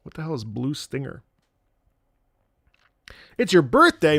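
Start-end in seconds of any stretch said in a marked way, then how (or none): none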